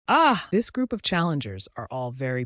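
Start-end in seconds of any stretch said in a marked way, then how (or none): 0.1-0.5 startled cry. Acted. Character cries out in fight.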